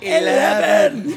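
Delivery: Scottish eleven. Pretend. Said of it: "two Scotsmen on the voice-activated elevator".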